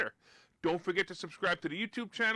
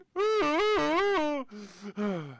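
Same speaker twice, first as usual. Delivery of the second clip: exasperated sigh; 9 semitones higher, 4 dB louder than usual. Acted. Exasperated at Omicron hype.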